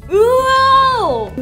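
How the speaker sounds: long, high, drawn-out wow; excited wow. Real, not acted. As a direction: stretch the wow way up in delight. Reacts to the beer's colour.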